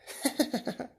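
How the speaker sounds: high breathy villain laugh; evil giggle. Acted. Deku laughing at his victim, a high breathy giggle. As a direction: cackle softly with delight.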